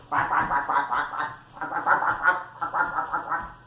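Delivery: rapid run of low, raspy quacks; noisy pretend duck chatter; quacking like a duck. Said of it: "and quack".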